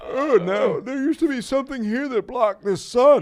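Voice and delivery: very high, loud comic wail; dismayed general. Acted. General waking to find ship gone, hammed-up wail. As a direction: wail it in mock waking distress.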